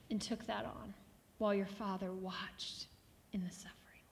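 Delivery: hushed prayer, swelling then sinking to whisper; heartfelt prayer. Real, not acted. Pastor praying to Jesus over his suffering, hushed heartfelt prayer. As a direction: pray with long pauses, overcome by the suffering. Leads prayer after reading.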